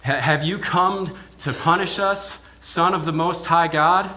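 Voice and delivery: loud and high, crying out from the tombs; demonic shout. Retold or imitated. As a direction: yell it out as a tormented spirit. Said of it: "this shout from".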